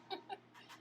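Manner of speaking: soft unvoiced chuckle; a little breathy laugh at herself. Real, not acted. Laughs at own confession.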